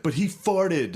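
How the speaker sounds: mild mock yell of outrage; retold yell. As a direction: blurt it like a protesting schoolkid. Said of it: "and I yelled that".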